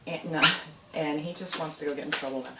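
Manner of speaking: ordinary talk, nothing marked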